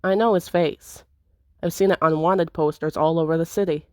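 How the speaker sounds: ordinary talk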